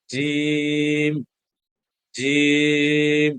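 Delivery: জিম held very long and slow, like a chant; drawn out long. Shown as a demonstration. Teacher showing online students the long জিম, drawn out. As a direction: stretch the vowel of জিম out for several beats. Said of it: "এটা কিন্তু আমি একটু লম্বা করে পড়লাম".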